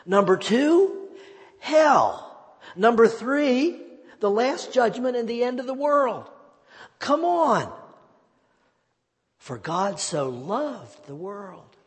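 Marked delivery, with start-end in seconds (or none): 0.0-7.7 high, booming hellfire list; loud fiery demand. Acted. Preacher voicing a crowd hungry for sin and hell, loud fiery demand. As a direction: punch each item out loud and high. Listing what congregation supposedly demands in sermons.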